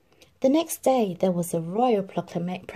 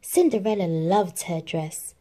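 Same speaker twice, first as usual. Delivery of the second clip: ordinary delivery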